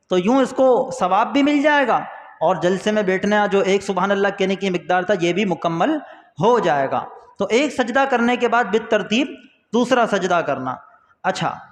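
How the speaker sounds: usual speaking voice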